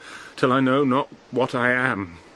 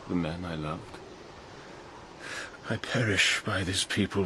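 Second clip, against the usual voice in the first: dying king; 4 semitones lower, 8 dB quieter than usual. Acted. Dying king after the battle.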